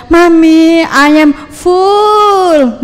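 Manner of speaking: suara anak kecil yang tinggi dan sangat lambat; meniru anak kecil. Retold or imitated. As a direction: tirukan si kecil merengek ke ibunya. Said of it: "Anak saya waktu kecil kenyang banget". (anak kecil bilang ke mami dengan suara tinggi lambat)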